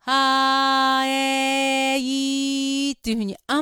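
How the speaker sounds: slow, sustained あーえーいー; wide vowel chain. Shown as a demonstration. Voice teacher showing vowels kept wide. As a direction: stretch あーえーいー wide and long. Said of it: "狭い母音にならないように".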